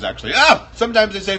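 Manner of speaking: normal talking voice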